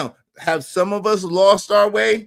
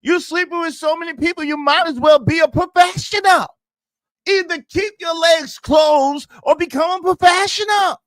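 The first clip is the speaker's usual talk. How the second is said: shouting; 7 semitones higher than usual. Real, not acted. Repeats louder, shouting.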